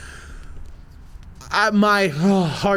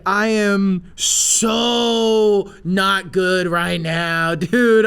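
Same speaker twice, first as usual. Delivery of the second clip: very drawn-out "so", strained; overwhelmed horror; 3 semitones higher, 4 dB louder, much slower than usual. Retold or imitated. Storyteller seeing snake pulled from pants, overwhelmed horror. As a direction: stretch it out, barely coping.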